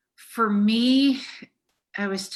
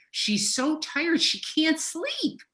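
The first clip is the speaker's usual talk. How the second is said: emotional; 8 semitones higher than usual. Real, not acted. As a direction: voice climbing high with upset. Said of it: "I'm gonna get emotional here".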